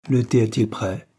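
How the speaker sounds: usual speaking voice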